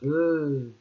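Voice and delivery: E as a long, drawn-out "euuuh"; hesitant "euh". Acted. Teacher imitating Madame E forgetting something, hesitant drawn-out "euh". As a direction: hold E like someone searching for an answer. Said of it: "Quand elle ne sait pas quelque chose".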